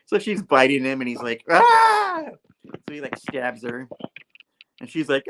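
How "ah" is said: high, loud imitated yelp of pain; hammed-up scream at being bitten; mock pained scream. Spidey bitten by Anna, mock scream.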